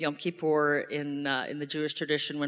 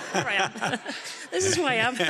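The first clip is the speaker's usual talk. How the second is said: teary laugh, higher; laughing through tears; 8 semitones higher, 5 dB louder than usual. Real, not acted. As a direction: laugh while welling up. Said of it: "the crying chancellor".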